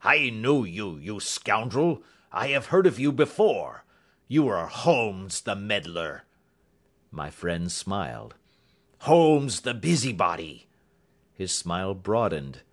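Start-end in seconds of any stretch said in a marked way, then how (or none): 0.0-6.2 angry accusation. Acted. Roylott denounces Holmes as a meddler, angry. 9.0-10.7 loud, contemptuous name-calling; scornful insult. Acted. Roylott insults a smiling Holmes, scornful.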